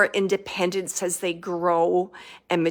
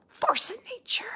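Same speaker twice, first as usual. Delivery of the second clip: whispered, quicker imitation of his words; relayed whisper; 6 dB quieter than usual. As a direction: whisper it as if passing on his message. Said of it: "like he's saying that".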